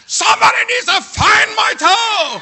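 loud, high demanding yell; frantic shout. Retold or imitated. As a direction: shout it at the whole room. Shouting at nurse and doctor.

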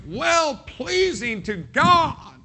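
high, slow, drawn-out reverent phrase; sung-out reverent reading; savored phrase. Acted. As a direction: linger on every word, lifted high. Preacher savoring a scripture phrase.

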